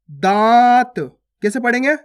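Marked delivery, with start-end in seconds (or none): 0.1-0.9 दांत with a long nasal vowel, no n consonant; nasalised vowel. Shown as a demonstration. Teacher modelling chandrabindu, loud long nasalised दांत. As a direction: draw out दांत through nose and mouth. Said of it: "चंद्र बिंदु की मात्रा".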